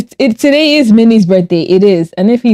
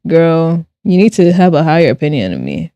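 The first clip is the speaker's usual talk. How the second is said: normal talking voice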